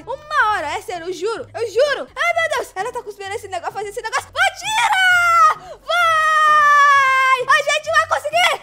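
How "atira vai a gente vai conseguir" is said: high, loud, drawn-out yells; stretched shouts of frantic hope; excited battle shout. Real, not acted. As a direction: urge it on at full volume. Climax of the boss fight.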